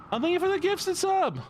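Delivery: put-on very high voice; high-pitched thanks. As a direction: gush thanks in a squeaky-high voice. Thanks viewer for gifted sub.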